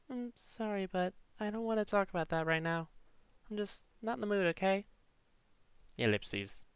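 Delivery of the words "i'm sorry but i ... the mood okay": put-on high, soft grieving girl; sad girl. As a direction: hold back tears, softly. Voicing Clover, upset.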